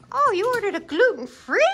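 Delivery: high, incredulous rising question; play-acted surprise, pitch way up; surprised. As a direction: query the order in exaggerated surprise. Reacting to a pretend passenger's order.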